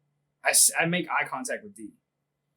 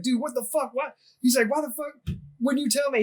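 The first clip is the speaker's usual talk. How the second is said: high-pitched outraged protest, retold; mock indignant disbelief, pitched way up; imitating a freak-out; 9 semitones higher than usual. Airman confronting his sergeant after learning the truth, flipping out. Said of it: "he just flips out".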